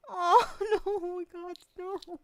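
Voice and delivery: high, breaking, real crying. Real, not acted. Streamer overwhelmed by viewers' kindness, crying. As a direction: let the tears take the words.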